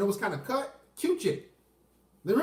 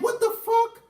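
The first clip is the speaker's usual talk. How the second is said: high, loud shocked outburst; 13 semitones higher, 4 dB louder than usual. Retold or imitated. Retelling the tarot date, a shocked outburst. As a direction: blurt it out in disbelief. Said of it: "I'm like".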